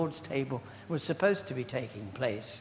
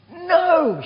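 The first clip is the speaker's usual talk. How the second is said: much higher and louder, a yelled No!; shouting like mad; 13 dB louder than usual. Retold or imitated. Patient shouting back to the deaf old man, loud shout. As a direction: yell it so the whole ward hears. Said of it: "shouting like mad".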